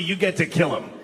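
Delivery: ordinary delivery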